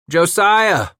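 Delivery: loud high call across distance; shout. Acted. Trapper shouting to his partner, loud call. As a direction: shout the name down the hill. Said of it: "he shouted".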